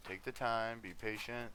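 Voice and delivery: usual speaking voice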